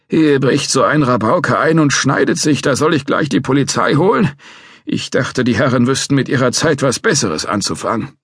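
put-on a cranky old guard threatening to call police; old watchman.

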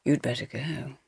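soft, low murmur. A narrator's reading. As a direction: murmur it close and quiet. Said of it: "Stephen murmured".

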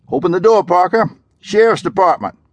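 loud, high official call through a door. Acted. Deputy at the door, demanding entry, loud and high. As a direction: bark it through the door to wake him.

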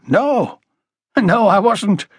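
high, dismissive reply; mildly scornful, pitched high; snorted denial. A narrator's reading. Woman denying fondness for the family, snorting. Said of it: "Miss Graham snorted".

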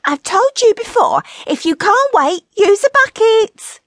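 high, loud scolding yell; piercingly high, irritated comic yell; yelling wife. A narrator's reading. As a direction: shout back in a high nagging wife's voice. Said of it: "Gretel yelled".

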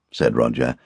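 ordinary delivery

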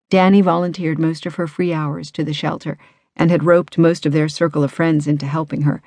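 usual speaking voice

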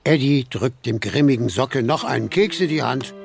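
ordinary talk

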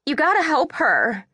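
high-pitched tearful plea; shaky and tearful. A narrator's reading. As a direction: plead for help, close to tears. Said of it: "her voice all shaky and her eyes filling with tears".

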